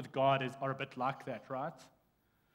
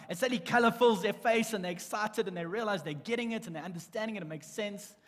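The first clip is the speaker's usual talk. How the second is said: excited; 5 semitones higher, 5 dB louder than usual. Acted. Describing students lighting up.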